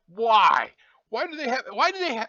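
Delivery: loud, high-pitched disbelief; real exasperated outburst. Real, not acted. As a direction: demand an answer, voice shooting up. Ranting about robots with hair.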